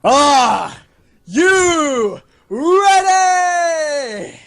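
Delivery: hype shout. Acted. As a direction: stretch it out like a ring announcer. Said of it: "I said".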